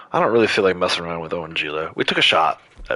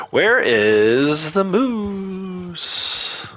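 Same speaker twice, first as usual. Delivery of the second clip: sing-song call; 11 semitones higher, much slower than usual. Pretend. Calling out searching.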